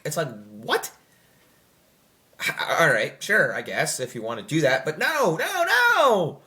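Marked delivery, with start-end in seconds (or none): 5.0-6.4 emphatic no. Real, not acted. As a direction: shut the idea down hard. Rejecting the sequel's idea.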